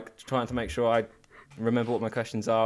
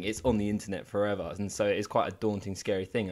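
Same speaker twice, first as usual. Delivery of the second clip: ordinary talk, nothing marked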